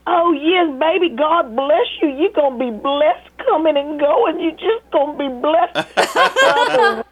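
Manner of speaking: high, warm blessing voice, slowing at the end; caller's voice. Retold or imitated. Grateful older caller blessing her, high and slow. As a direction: bless them like a sweet church lady. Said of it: "she's like".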